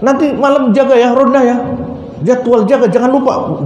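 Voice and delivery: thief bosses the wife about watch duty; scolding. Retold or imitated. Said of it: "Dimarah-marah". (omelan tinggi pura-pura)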